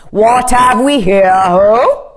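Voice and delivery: loud, grand king's demand. A narrator's reading. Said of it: "he demanded".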